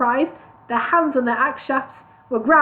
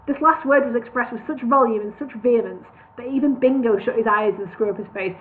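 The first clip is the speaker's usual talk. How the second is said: usual speaking voice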